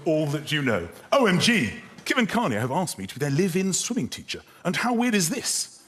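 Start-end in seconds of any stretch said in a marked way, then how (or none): 1.1-4.4 brisk, flowing read-through; quick, continuous read; reading a text back. Acted. Reads the finished text back in full. 4.6-5.8 higher, in one flowing phrase; lifted, continuous question; reading a text back. Acted. Reads the finished text back in full.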